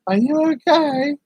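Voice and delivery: high, drawn-out mock check-in; imitating concerned question. Rufus checking on the sleeper, imitated concern.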